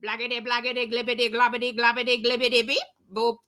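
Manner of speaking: monotone rattle of nonsense; silly sounds. Acted. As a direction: patter it out evenly on one note. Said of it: "make silly sounds like".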